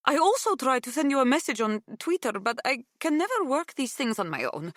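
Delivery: put-on excited, high Greek-accented chatter; thrilled woman on the phone, Greek accent; voice climbing in pitch. A narrator's reading. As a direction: gush loudly, pitch rising as you go. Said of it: "her voice climbing in pitch".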